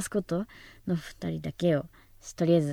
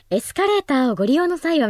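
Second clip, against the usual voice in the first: higher, louder station announcement voice; announcer voice; 8 semitones higher, 6 dB louder than usual. Shown as a demonstration. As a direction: read it like a formal PA announcer. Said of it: "みたいな声も出るし".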